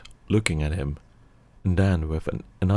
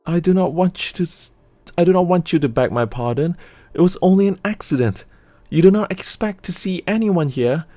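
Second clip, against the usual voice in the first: put-on much higher, fast, nervous young woman, faltering; trembling; 11 semitones higher, 5 dB louder, faster than usual. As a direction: stammer out a frightened plea with a sigh. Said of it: "said Stella, trembling".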